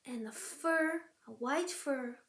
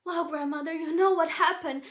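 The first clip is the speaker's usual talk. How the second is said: put-on louder, higher pleading girl; 3 semitones higher, 9 dB louder than usual. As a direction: plead with rising urgency. Girl pleads to old woman.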